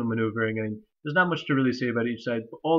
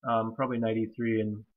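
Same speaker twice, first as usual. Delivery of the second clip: ordinary talk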